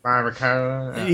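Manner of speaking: put-on barbacoa drawled slowly in a Southern accent; accento del Sud. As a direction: stretch out barbacoa, Southern style. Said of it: "Southern accent saying barbacoa".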